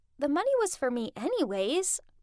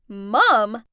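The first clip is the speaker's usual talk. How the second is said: shocked shout; 4 semitones lower, 10 dB louder than usual. Acted. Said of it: "all shouted".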